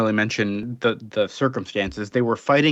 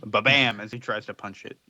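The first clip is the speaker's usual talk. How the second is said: normal talking voice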